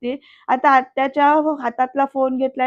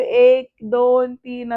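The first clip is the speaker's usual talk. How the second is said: a very slow, drawn-out count; slow breathing count; much slower than usual. Retold or imitated. Surya counts breaths for panicking Digu mama, very slow calm count. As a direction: stretch each number like guided breathing.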